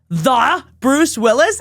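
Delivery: slow amazed question; drawn out disbelief; slow mock disbelief; astonished dad. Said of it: "And he goes".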